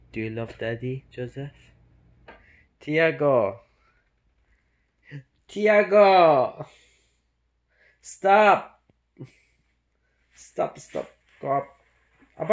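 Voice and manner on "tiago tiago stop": loud sharp yell; shouting. Real, not acted. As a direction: yell to stop the dog. Yelling at dog again.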